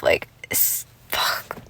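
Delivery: breathy under-breath swear; real whispered curse. Real, not acted. Fed-up viewer whispering a curse at the show. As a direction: let the frustration slip out in a whisper. Muttered curse after angry rant.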